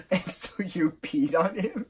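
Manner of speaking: high, questioning imitation; acted incredulous mom. Acts out mom questioning Greg.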